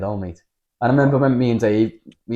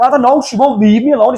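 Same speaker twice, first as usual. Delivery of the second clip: exasperated reply; 12 semitones higher, 7 dB louder than usual. Retold or imitated. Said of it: "I was like".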